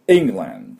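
England with the opening E pushed from the back of the throat; England, strong first vowel from the throat; throaty first E. Shown as a demonstration. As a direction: start England with a sharp throaty E. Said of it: "い、い、喉の奥で".

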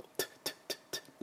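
quick voiceless "トゥッ" bursts, like spitting without spit; spitting T sound. Shown as a demonstration. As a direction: puff out repeated "トゥッ". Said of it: "唾を飛ばさず飛ばす音".

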